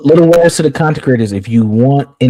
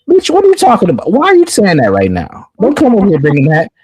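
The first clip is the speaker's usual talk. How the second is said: sassy retort; 7 semitones higher than usual. Retold or imitated. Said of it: "I checked her".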